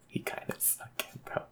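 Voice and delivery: breathy whispered aside; whispering. Real, not acted. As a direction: whisper it under laughter.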